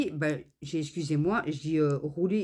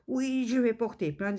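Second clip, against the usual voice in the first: imitating the courier; 7 semitones higher than usual. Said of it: "imitating the courier again".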